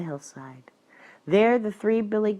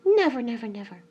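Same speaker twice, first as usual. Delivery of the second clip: high, scared hen, dropping away at the end; frightened promise; 7 semitones higher, 5 dB louder, faster than usual. A narrator's reading. Hen reassures the fierce peacock, high and afraid. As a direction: swear it fearfully in a high voice. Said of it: "who was afraid".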